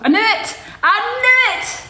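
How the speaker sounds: high, loud "I knew it" cry; triumphant. Real, not acted. Guessing a viewer's hometown correctly, loud and triumphant. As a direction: crow over the right guess.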